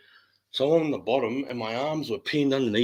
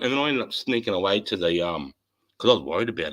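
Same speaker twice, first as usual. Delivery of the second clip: normal talking voice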